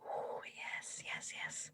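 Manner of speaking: hushed, whispered approval; delighted whisper. Real, not acted. Game master whispers approval of the player's plan, hushed delight. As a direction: whisper it eagerly under the player's talk.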